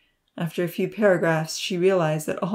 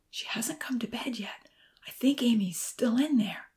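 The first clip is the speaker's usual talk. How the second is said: soft secretive whisper; 5 semitones higher, 5 dB quieter than usual. A narrator's reading. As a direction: hush it like a sneaking child. Children sneaking outside the door.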